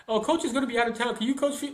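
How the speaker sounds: high, quick imitation of asking parents; pleading parents. Voices parents asking her favour.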